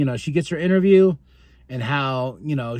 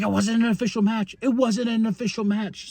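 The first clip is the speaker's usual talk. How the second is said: whiny protest; 5 semitones higher than usual. Retold or imitated. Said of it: "He's like".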